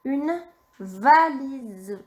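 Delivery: "une valise" stretched out very slowly; very slow dictation. Shown as a demonstration. Teacher dictating the last word, very slowly. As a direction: draw out "une valise" for the child to write. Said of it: "Le dernier mot".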